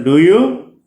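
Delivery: "do you" slowly, pitch climbing on "you"; rising intonation. Shown as a demonstration. Teacher showing an echo question, rising on "you". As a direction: lift "you" into a questioning rise. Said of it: "It's a rising intonation".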